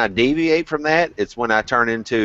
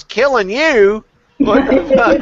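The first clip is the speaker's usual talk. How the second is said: higher, louder, slow mock outrage breaking into a laugh; indignant yell; 12 semitones higher, 6 dB louder, slower than usual. Retelling Brett's joking outrage to friends, loud and higher with a laugh. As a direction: play up his disbelief for laughs. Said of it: "he goes".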